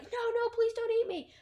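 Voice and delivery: high, loud scream of terror; a mock scream from the guy at the back; panicked plea. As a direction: beg not to be eaten, shrieking. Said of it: "he's like".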